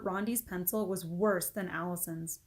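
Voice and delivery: usual speaking voice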